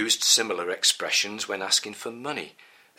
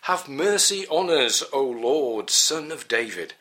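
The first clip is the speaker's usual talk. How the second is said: raised, higher and louder plea; imploring call, pitched up and loud; 7 semitones higher, 6 dB louder than usual. A narrator's reading. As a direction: cry out for mercy to someone passing. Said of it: "and called out to him".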